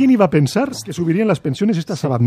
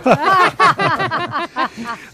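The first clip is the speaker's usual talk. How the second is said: laughing; 12 semitones higher than usual. Real, not acted. Laughter at own joke.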